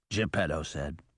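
usual speaking voice

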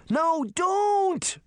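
high, drawn-out plea; alarmed cry. A narrator's reading. Daphne pleading as Puck flies indoors, crying out. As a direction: cry out to stop, pitched high. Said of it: "Daphne cried".

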